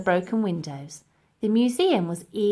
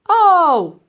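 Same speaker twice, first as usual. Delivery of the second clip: high, loud yelp of pain; a loud pained cry, pitched high; 9 semitones higher, 12 dB louder than usual. A narrator's reading. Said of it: "yelped the professor".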